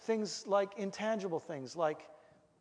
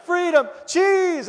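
high, loud, drawn-out cry; dog's decision; 11 semitones higher, 12 dB louder, slower than usual. Retold or imitated. Runaway dog turning back for cheese, loud high cry. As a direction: imitate a dog loudly making up its mind. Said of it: "you just see him go".

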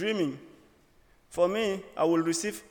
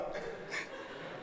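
breathy soft laugh; 14 dB quieter than usual. Real, not acted. Laughter after the directions story.

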